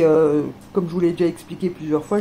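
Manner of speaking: normal talking voice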